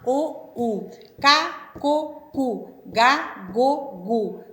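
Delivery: loud syllables "Co", "Cu", "Ga", "Go", "Gu"; syllable demo. Shown as a demonstration. Teacher sounding out C and G syllables for a child. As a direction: sound out "Ga", "Go", "Gu" crisply, one at a time. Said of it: "a letra C e a letra G".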